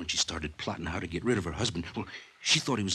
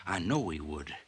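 ordinary talk, nothing marked

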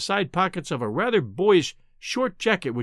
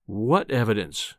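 low, slow, controlled menace; menacing calm; 5 semitones lower than usual. A narrator's reading. Professor challenging his visitor's claim, menacingly calm. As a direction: ask it slowly and dangerously calmly. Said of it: "with a menacing calm".

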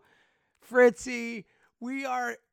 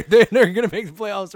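laughing quote; 2 semitones lower, 7 dB louder than usual. Retold or imitated. Mock quote while laughing.

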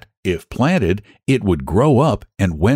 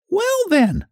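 much higher hen voice, resigned; little red hen. Acted. Hen answering the lazy animals, high resigned voice. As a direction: shrug and take it on yourself. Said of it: "said the little red hen".

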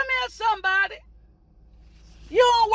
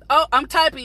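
normal talking voice

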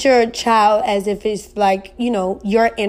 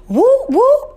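high, loud whooping cheer; 6 dB louder than usual. Real, not acted. Cheering a listener's zodiac team.

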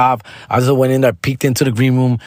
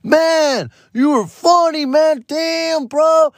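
much higher, hyped goofy voice; silly Joy Coy; much slower than usual. Retold or imitated. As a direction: play him as a hyped goofball. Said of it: "Joy Coy being fucking, you know, silly ass".

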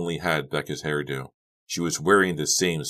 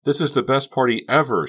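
high, loud party enthusiasm; delighted outburst, pitched up; happy; 9 semitones higher, 6 dB louder than usual. A narrator's reading. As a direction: gush happily over the noise. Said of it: "Becca felt happy".

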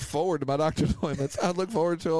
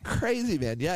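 ordinary delivery

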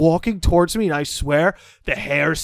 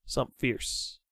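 quiet, breathy repeat; soft dramatic echo; whispered repeat for effect; hushed echo; 4 semitones lower, 13 dB quieter than usual. Real, not acted. Echoing co-host, hushed.

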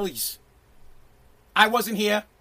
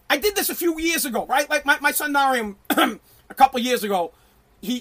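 ordinary talk, nothing marked

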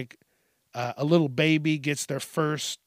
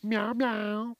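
high, soft pretend meows; house cat meowing; 6 semitones higher, 5 dB quieter than usual. Pretend cat refusing to go outside, soft meowing. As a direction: mew softly and high like a cat.

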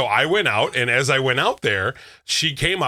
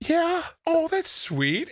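high-pitched acted thank-you; gushing polite reply; 4 dB quieter, much slower than usual. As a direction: coo it high, acting touched. Acts out polite reply to fan.

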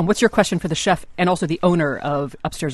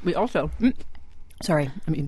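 mouth full. Real, not acted. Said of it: "I'm talking with my mouth full".

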